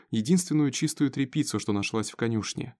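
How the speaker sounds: ordinary delivery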